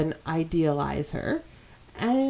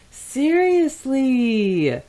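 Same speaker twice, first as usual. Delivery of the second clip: drawn-out, higher, louder seriously; exasperated; 7 semitones higher, 6 dB louder, much slower than usual. Real, not acted. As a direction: stretch it out in disbelief. Scolding a character, exasperated.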